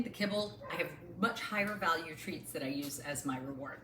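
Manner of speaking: ordinary talk, nothing marked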